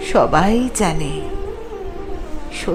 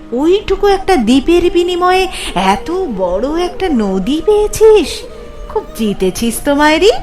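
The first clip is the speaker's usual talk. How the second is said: higher, animated voice of admiring friends; impressed friends; 5 semitones higher than usual. Acted. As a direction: congratulate a pal on a great bargain, pitched up. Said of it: "বন্ধুরা বলল".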